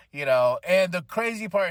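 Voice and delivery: ordinary talk, nothing marked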